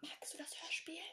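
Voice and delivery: breathy whisper, no voice. Real, not acted. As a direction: whisper so it can't be understood. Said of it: "Leute, ich flüster jetzt".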